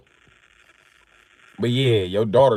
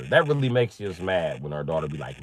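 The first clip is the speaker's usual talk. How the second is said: ordinary delivery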